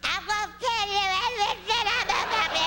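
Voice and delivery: put-on high, slurred old lady mumble; mumbling grandmother. Ninety-eight-year-old grandmother answering her granddaughter, high slurred mumble. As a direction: garble it like a toothless old granny. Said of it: "old people's tongue disease".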